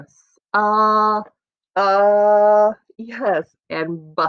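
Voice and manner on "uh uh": "uh" held long as a lone vowel; isolated middle sound. Shown as a demonstration. Teacher asking about the middle sound, isolated and held. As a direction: draw out "uh" on its own, raised in pitch. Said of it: "How about the middle sound?".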